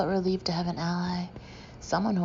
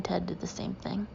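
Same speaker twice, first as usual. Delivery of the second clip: whisper; 8 dB quieter than usual. Real, not acted. Aside dropped to a whisper.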